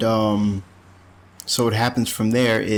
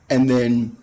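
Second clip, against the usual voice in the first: ordinary talk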